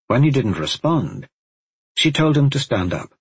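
plain reading voice

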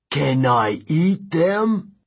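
slow, dim hungry giant. Acted. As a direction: drawl it like a hungry monster. Giant asks to eat heroes.

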